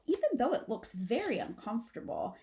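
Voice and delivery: usual speaking voice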